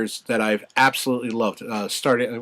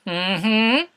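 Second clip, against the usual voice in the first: usual speaking voice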